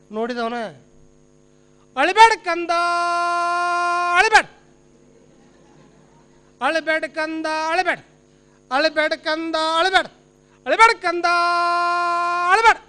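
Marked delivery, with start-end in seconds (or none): 2.0-12.8 drill-command lullaby; military man. Acted. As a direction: soothe a child like parade orders, long call then abrupt finish. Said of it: "ನೋಡಿದ ತಕ್ಷಣ ಇವಾಗ ದೇಶ ಪ್ರೇಮ ಹೆಚ್ಚಾಗಿ ಬಿಡ್ತ್ರಿ".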